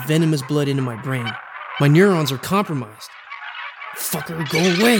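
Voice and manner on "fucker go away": furious hiss turning into a yell; ground through clenched teeth. Retold or imitated. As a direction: spit it through gritted teeth. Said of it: "I grind through my clenched teeth".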